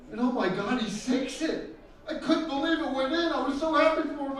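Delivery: normal talking voice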